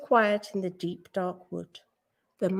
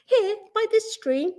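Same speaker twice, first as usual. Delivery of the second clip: higher, lively storybook character voices; lilting questions and answers in a higher voice; story dialogue; 13 semitones higher, 4 dB louder than usual. A narrator's reading. Mouse and owl converse in story.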